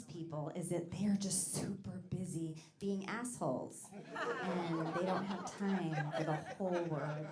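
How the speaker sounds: slow hushed confiding whisper. Real, not acted. Comedian confiding to the audience in a slow whisper. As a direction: lean in and whisper it slowly. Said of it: "whispered punchline".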